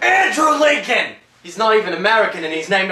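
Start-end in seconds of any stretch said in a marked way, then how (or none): 0.0-1.1 high, loud, slow exclamation; emphatic slow call-out of the name; loud correction. Real, not acted. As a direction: shout the right name out slowly.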